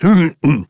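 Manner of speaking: official clearing his throat. A narrator's reading. Commissaire preparing to question Catherine, throat clearing. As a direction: clear the throat before speaking. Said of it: "s'éclaircit la voix".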